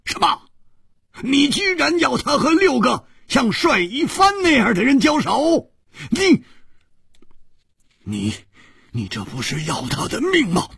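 a high, shocked protest that trips over its words; jumping up in alarm. A narrator's reading. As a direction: leap up and sputter it out. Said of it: "他跳起来了".

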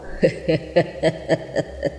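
hushed, voiceless staccato laugh; a mocking spectre's quiet chuckle; ghostly cackle. Ghosts laugh after their threat.

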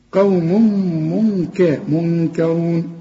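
منكرون sung out slowly with full ر; melodic recitation. Shown as a demonstration. Teacher to student: chanted correct منكرون. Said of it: "ما تدي كل حرف حقه".

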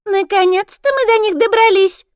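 shrill gloating creature voice; squeaky voice. A narrator's reading. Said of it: "песклявым голосом".

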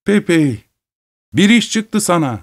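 high-pitched call from the doorway. A narrator's reading. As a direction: call someone over from afar. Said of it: "kulübenin kapısından bağırdı".

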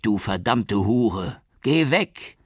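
slow curse, then a very high screamed "geh weg" with "geh" rising; furious yell. A narrator's reading. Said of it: "brüllte er".

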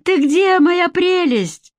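a friend sugaring a call to a crying friend; mock sweet. A narrator's reading. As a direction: coo the question with put-on sweetness. Olshanskaya cooing on the phone.

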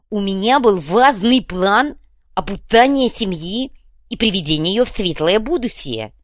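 loud, pompous, lisping declaration; self-important. A narrator's reading. Kuvalda explains his important plan, self-important. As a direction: puff it up like a grand programme. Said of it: "важно ответил Кувалда".

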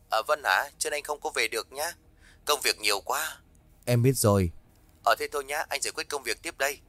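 husband calling from work, a distant phone voice; over the phone. Acted. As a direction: sound far off on a phone line.

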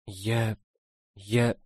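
faint, hesitant and afraid; frightened stammer. A narrator's reading. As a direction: barely get the words out. Frightened girl stammering before confession.

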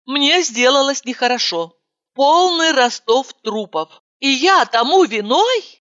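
plain reading voice

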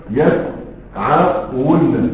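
يسعون drawn out very slowly, the waw given a damma and the nun doubled: yas'awunna; teacher spelling out يسعون very slowly, yas'awunna; slow careful demo. Shown as a demonstration. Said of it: "تضم الواو نفسها".

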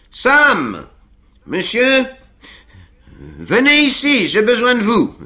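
high, loud call from across the ice; Pickwick calling across. A narrator's reading. Pickwick calling Sam from the far bank, loud. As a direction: shout to be heard over the rink. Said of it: "appela de l'autre rive".

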